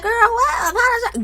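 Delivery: high, laughing disbelief as Tisha; mock incredulous Tisha. Host mimics Tisha refusing to apologize, high and laughing. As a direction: squeal it like you can't believe the ask. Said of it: "Tisha's like".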